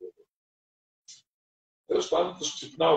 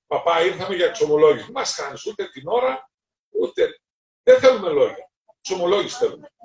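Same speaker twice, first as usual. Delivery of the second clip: louder, higher, fast demand building to a shout; insistent demand; 5 semitones higher, 5 dB louder than usual. Retold or imitated. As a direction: push like a pushy young biker. Biker demands confession from priest. (επίμονη, δυνατή απαίτηση νεαρού μηχανόβιου)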